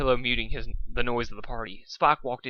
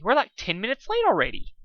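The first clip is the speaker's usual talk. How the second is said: excited; 10 semitones higher, 3 dB louder than usual. Acted. High excited.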